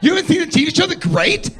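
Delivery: high, loud disbelieving squeal; shocked girlfriend. Retold or imitated. Kate, shocked he missed the show, a high squeal. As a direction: mimic her outraged disbelief, way up high. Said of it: "She's like".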